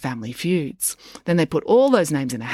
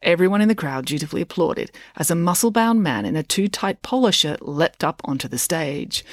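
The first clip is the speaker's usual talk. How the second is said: ordinary delivery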